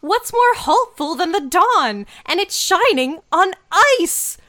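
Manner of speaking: high, loud, hammed-up excitement; over-dramatic heroine cracking the riddle; mock triumphant realization. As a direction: act out a grand eureka, rising to a shout.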